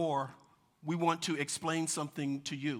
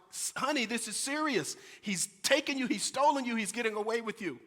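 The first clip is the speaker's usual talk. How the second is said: a hint of a worried father, pitched up; urgent father; 6 semitones higher than usual. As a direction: plead with the child to take it seriously. Father pleading with laughing daughter.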